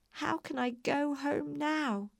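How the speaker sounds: slow, high, plaintive question; tearful lament. A narrator's reading. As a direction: draw it out forlornly, pitched high. Dorothy cries, left behind.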